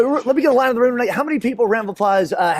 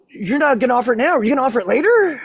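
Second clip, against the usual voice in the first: put-on quick, higher questioning kid voice; puzzled child; 3 semitones higher, faster than usual. Son trying to crack mom's code, puzzled. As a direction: imitate a boy urgently working out a riddle. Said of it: "he tries to crack the code".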